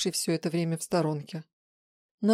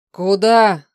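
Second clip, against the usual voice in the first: very loud, angry demand; growled challenge; 2 semitones higher, 14 dB louder than usual. A narrator's reading. Man rushing after her, loud angry growl. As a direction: bellow it to stop someone. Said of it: "прорычал он".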